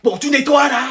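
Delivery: high, impatient order; mother snapping. Acted. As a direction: snap at your kid to get on with it. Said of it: "t'as fait que de me crier dessus". (mère qui houspille son enfant)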